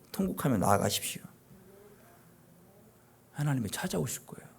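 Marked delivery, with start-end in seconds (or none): none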